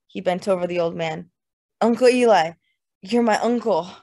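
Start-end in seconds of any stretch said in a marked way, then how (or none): 1.8-3.9 put-on loud, drunken emotional appeal; ripped, swollen voice. A narrator's reading. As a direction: call to him with swollen feeling. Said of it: "His voice was ripped and swollen".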